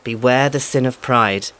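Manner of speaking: usual speaking voice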